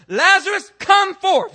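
high, loud call of Lazarus, come forth; commanding shout. Acted. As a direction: bellow the command, no counselling. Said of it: "Jesus said".

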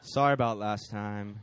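low, deflated mumbled apology; slow and downcast, all fight gone; bummed out. Acted. Dejected warlock apologizing to the woman, low and slow. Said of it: "really kind of bummed out".

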